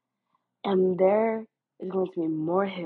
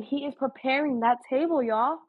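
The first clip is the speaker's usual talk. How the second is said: "He is preparing that table" said again, pitched higher for emphasis; emphatic, pitched higher; 6 semitones higher than usual. Real, not acted. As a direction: push "He is preparing that table" up high as a proclamation. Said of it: "I'm saying it again".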